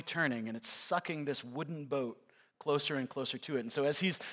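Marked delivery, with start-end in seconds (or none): none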